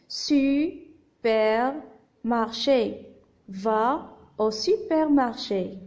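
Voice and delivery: slow, stretched reading aloud; syllable by syllable. Shown as a demonstration. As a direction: read slowly, parting each syllable. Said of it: "j'ai séparé le texte en syllabes".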